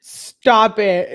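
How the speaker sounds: real laughing protest; laughing stop it. Real, not acted. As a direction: protest while cracking up. Laughing at Celie.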